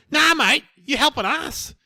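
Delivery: high, loud mock bloke insisting; imitating a pushy crook; insistent criminal. Criminal insisting on being served.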